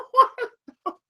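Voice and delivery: squeaky high disbelief through laughter; high laughing what. Real, not acted. Vlogger stunned by the news, high laughing what.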